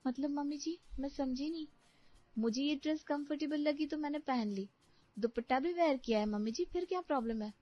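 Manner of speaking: put-on puzzled young daughter-in-law. New bride answers her mother-in-law about her clothes, puzzled. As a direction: play a mildly protesting daughter-in-law.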